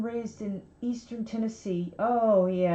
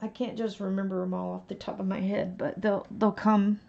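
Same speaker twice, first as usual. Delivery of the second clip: ordinary talk, nothing marked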